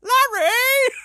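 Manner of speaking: very high, loud parrot shriek; piercing questioning bird squawk. Acted. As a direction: screech the name like a parrot. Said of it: "Is the parrot going".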